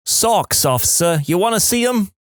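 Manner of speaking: high, hammed-up Jersey accent; fake Jersey accent. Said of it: "In my fakest Jersey accent".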